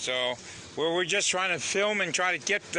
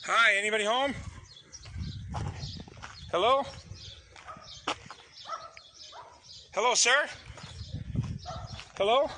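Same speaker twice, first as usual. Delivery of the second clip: raised, high calling voice; calling down a hallway; 7 semitones higher than usual. Real, not acted. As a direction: call out to anyone inside. Calling out at a house.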